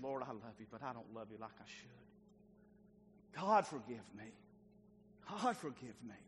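low, soft, slow broken plea; broken confession. Retold or imitated. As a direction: confess softly with a breaking heart. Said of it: "Peter was broken".

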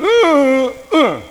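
loud high grunts "uh, uh"; imitated grunting kid. Kid walks up and grunts at the preacher, imitated grunts. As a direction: mimic a kid grunting at you. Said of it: "and he goes".

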